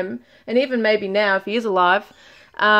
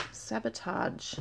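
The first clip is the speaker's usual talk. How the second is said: murmured; 15 dB quieter, slower than usual. Real, not acted. Reading card names to herself.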